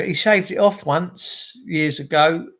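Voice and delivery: ordinary delivery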